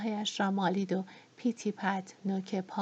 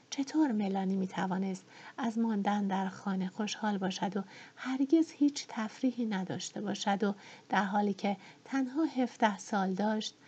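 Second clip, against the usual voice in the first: usual speaking voice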